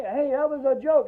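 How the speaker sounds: acted insistent backtracking; urgent interruption. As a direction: cut in to stop him. Trying again to stop the doctor.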